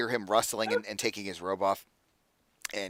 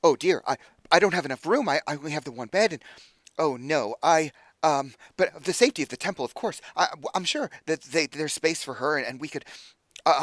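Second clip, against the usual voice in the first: higher, stammering flustered delight; excited, flustered; 4 semitones higher than usual. Acted. Friend asked to shelter a sister, excited and flustered. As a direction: trip over your own thoughts with excitement. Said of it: "he's so excited".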